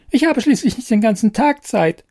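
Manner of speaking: a tiny fairy hurrying him along; impatient fairy. Acted.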